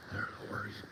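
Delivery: whispered aside to himself. Real, not acted. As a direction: drop to a breathy whisper, unsure. Looking for dog on trail.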